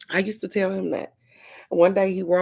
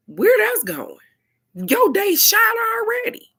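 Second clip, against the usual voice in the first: sudden much higher, louder exaggerated voice; mock squeak; 7 dB louder than usual. Wife mocking husband's ruined day, in a high squeaky voice.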